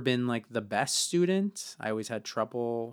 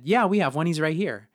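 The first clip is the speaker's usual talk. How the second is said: higher, louder, bright sales pitch; eager boss; 7 semitones higher, 8 dB louder than usual. Retold or imitated. Boss tells customer the astrologer is here, eager. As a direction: sell him to the customer on the spot.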